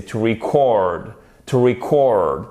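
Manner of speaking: record as the verb, ri-CORD, drawn out; "to record" with short I and late stress; stress on second syllable. Shown as a demonstration. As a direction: slowly land on the CORD of record. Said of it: "on a l'accentuation sur la seconde syllabe".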